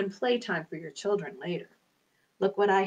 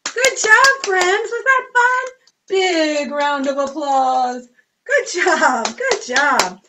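cheering; 2 semitones higher, 6 dB louder, slower than usual. Real, not acted. Cheering children.

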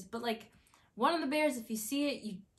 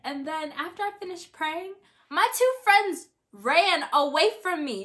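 normal talking voice

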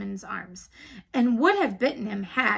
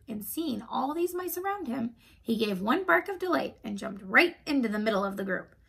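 usual speaking voice

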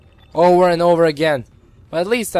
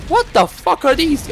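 surprised; 10 semitones higher than usual. Real, not acted. Startled by strange game enemies.

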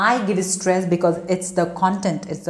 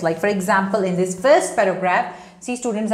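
ordinary talk

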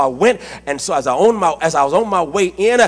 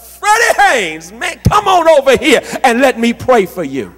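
imitated holler; 4 semitones higher than usual. Patient sits up to summon the pastor, imitated holler. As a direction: mimic a hearty hail from a sickbed.

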